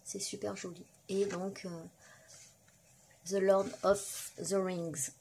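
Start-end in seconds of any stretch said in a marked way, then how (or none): 3.2-5.1 slow, deliberate English with a French accent. Real, not acted. Vlogger reading out the film title on a card, French-accented English. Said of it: "Avec mon superbe accent".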